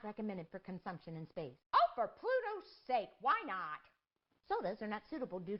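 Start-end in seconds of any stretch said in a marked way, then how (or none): 1.7-3.8 exasperated. Real, not acted. Frustrated exclamation.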